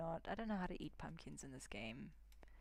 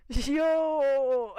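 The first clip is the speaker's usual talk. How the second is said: much louder, shouted greeting into a big laugh; loud yell then laugh; 9 semitones higher, 16 dB louder than usual. Real, not acted. Yelling at villager, laughing.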